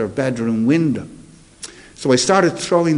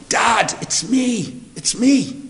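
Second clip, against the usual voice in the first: high, slow pleading call; 9 semitones higher, much slower than usual. Retold or imitated. Son calling up to his father under the street lamp, high drawn-out call. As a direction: call up to the window, much higher and drawn out.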